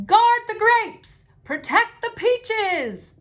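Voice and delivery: high, loud, slow howl; Daddy howling to save the fruit. A narrator's reading. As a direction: bellow orders through the hail. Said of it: "Daddy howled".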